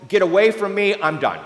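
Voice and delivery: a loud dismissal from a God who is done; mildly voiced angry God. A narrator's reading. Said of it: "God has had it with Israel".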